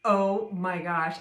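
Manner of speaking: drawn-out nervous exclamation; anxious oh my gosh. Retold or imitated. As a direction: dread as the ride starts. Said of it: "And I was like".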